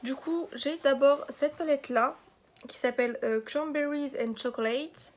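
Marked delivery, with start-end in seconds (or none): 3.4-5.1 French speaker's showy, drawn-out English; over-the-top English accent. Real, not acted. Vlogger reading out a palette's English name, over-the-top English accent. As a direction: say it slowly in an exaggerated English accent. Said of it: "Mon accent de ouf".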